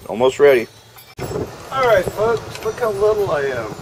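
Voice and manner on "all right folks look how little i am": much higher, small little voice; tiny high voice. Pretend. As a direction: sound tiny and squeaky. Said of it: "Got little all of a sudden".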